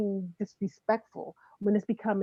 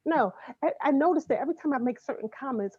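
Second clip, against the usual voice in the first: normal talking voice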